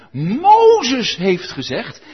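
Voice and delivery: Sadducees' words slowed and pushed out loud; slow, loud quote. Retold or imitated. Preacher quoting the Sadducees to the congregation, slow and loud. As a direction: lay the quote down heavy and slow.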